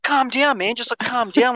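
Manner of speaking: high-pitched, agitated retold plea; agitated appeal. Blocked-in guy to angry delivery driver, agitated appeal.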